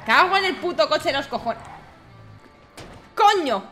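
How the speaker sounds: loud high swearing with a laugh; angry outburst. Acted. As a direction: swear at the broken car. Car won't start.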